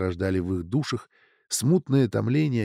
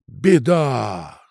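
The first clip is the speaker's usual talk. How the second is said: loud drawn-out cry of dismay; loud wail of alarm; 2 semitones higher, 6 dB louder than usual. A narrator's reading. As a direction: cry out as if disaster struck. Heroine cries out in alarm.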